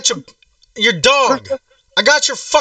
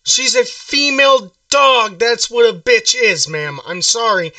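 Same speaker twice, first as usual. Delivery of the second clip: slow lecturing; slower than usual. Acted. Prank caller lecturing slowly.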